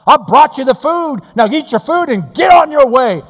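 loud, high hammed-up telling off; what Abigail did not shout at David; mock angry scolding. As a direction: scold him like a fed-up wife. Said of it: "She didn't say".

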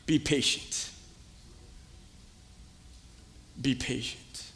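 hushed, low and gentle; soft voice of God. Pretend. God gently answering the impatient believer, soft and low. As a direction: let God answer softly, almost under breath.

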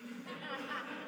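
soft breathy laugh at his own joke; light chuckle. Real, not acted. Pastor laughing at his own tithe joke, soft breathy chuckle. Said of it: "Just kidding".

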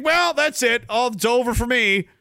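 high-pitched mock despair; hammed-up resignation; acted despair. Acts bereaved man giving up.

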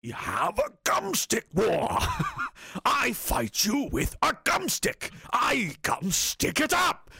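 slow menacing butcher growl. Retold or imitated. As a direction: read it like the gangster butcher. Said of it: "Cue up the butcher voice".

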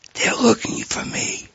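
slow whisper with a hint of giggle; giggly whisper. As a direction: whisper it like a kid pleased at hiding. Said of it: "Still whispering, kinda giggling".

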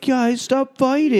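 high, drawn-out plea; slow, high-pitched whimsical dog voice; pleading dog. Acted. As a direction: voice a worried dog begging. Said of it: "face of a dog that's like".

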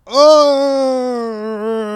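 loud high pained "Oh"; sudden yelp of pain; pained groan. Retold or imitated. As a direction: cry out as the spine cracks. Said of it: "I was like".

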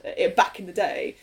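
normal talking voice

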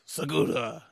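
imitating a put-on Japanese pronunciation; lightly hammed-up Japanese accent; mock Japanese accent. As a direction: say the surname as if it were Japanese. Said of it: "'cause you're saying it like that".

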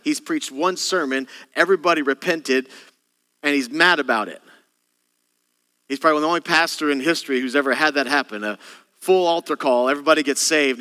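ordinary talk

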